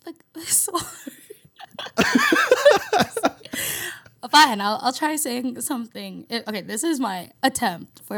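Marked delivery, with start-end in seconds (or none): 0.0-1.9 breathy laugh through words. Real, not acted. Laughing nervously. 2.0-4.0 shrill giggle burst; high squeal laugh. Real, not acted.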